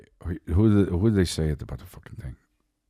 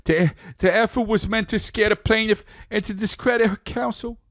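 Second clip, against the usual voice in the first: much higher reading voice; mock statement read; 14 semitones higher than usual.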